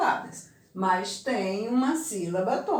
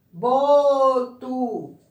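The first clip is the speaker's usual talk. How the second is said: Boto with a loud, stretched BO; stress on first syllable; 3 semitones higher, 7 dB louder than usual. Shown as a demonstration. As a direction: punch and hold the BO in Boto. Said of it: "a sílaba pronunciada com mais força".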